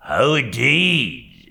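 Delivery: slow drawn-out "Oh, indeed" with a lift; fawning slow exclamation; Uriah exclaiming. A narrator's reading. Uriah exclaiming to David, slow and fawning. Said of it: "exclaimed Uriah".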